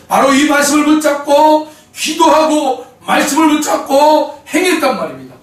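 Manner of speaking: high, loud preacher's cry rising on each phrase; fiery preaching. Acted. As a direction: preach it out to the congregation with full force. Preaching full force.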